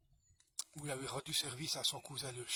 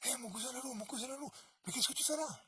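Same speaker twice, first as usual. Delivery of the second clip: voix haute et étonnée du chien; un chien qui découvre son cousin dehors; chien surpris; 9 semitones higher, faster than usual. Acted. Le chien répond au loup. (le chien, étonné, interpelle le loup)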